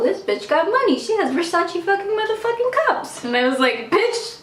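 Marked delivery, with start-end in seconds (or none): 0.0-3.2 gushing friend. Retold or imitated. Friend's gushing quote, rising high. 3.9-4.4 mock indignant squeal. Retorting to friend, squealed high.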